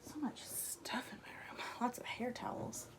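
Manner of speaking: hushed, breathy mutter to herself; whispered aside. Real, not acted. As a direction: drop to a whisper, talking to yourself. Muttered aside about messy room.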